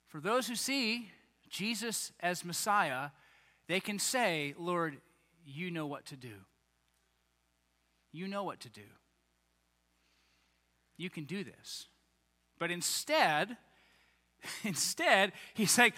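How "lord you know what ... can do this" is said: soft pleading. Retold or imitated. Imagined believer, soft plea.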